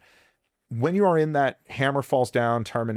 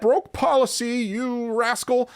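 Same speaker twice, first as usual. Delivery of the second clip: mock scolding; 11 semitones higher, slower than usual. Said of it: "that is far more serious than".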